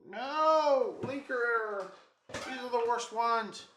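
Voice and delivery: loud, high wail of dismay; high-pitched, hammed-up lament; dismayed outcry. Real, not acted. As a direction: cry out in exasperated despair. Cries out at a linker error.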